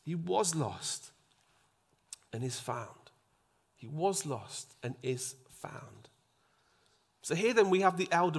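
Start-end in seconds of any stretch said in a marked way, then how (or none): 0.0-3.1 slow, softer closing words; quiet father. Retold or imitated. Father softly ending his plea to his son. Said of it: "the father says". 3.7-6.1 slow, breathy whisper. Acted. Preacher whispering the verse back to the congregation.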